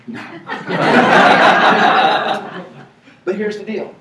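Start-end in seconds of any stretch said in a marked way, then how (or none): 0.2-2.8 big laugh; loud laugh. Real, not acted. Speaker laughing at the audience member's offer, loud laugh.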